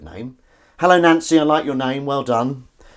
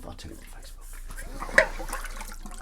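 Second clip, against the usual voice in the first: whispered aside; 6 dB quieter than usual. Real, not acted. Hushed aside about telling later.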